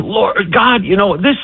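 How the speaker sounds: high-pitched pleading prayer; crying out to God. Retold or imitated. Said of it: "he's crying out, and he's saying".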